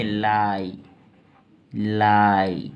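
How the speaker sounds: लाई said low with a long "aai"; pronunciation demo. Shown as a demonstration. Teacher showing how this is pronounced, careful low demo of लाई. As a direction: model लाई as a separate, held word. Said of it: "इसको बोलेंगे".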